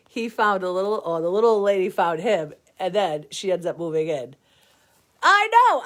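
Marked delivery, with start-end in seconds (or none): 5.2-5.8 1 excited squeal. Real, not acted. Excited agreement.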